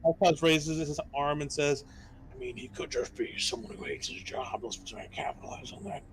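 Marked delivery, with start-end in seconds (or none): 2.3-6.0 soft hushed whisper. Acted. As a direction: whisper it so the guard can't hear. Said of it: "Why are we whispering?".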